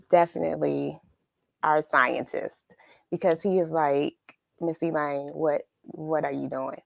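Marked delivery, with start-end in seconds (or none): none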